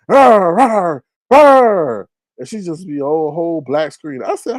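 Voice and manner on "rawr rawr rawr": high, loud "rawr" noises; mock screaming roar. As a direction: imitate incoherent yelling with big roars. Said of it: "She over there screaming and yelling".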